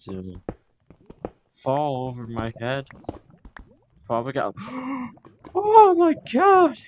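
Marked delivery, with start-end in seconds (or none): 4.6-5.5 sudden high gasp; sharp startled gasp. Real, not acted. Creeper startles the player. 5.6-6.8 high, loud shocked shriek. Real, not acted. Creeper startles the player.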